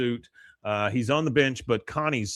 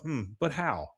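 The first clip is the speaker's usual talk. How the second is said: to herself; 2 semitones higher, 8 dB quieter than usual. Retold or imitated. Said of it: "says to herself".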